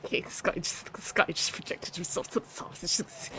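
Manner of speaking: voiceless, wheezing laughed-out words; helpless laughter. Real, not acted. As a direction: squeeze the words out through real laughter. Overcome by laughter at a message.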